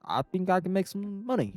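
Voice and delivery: high mock-Italian voice; high-pitched Italian caricature; Italian accent. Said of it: "he's just got the Italian powers".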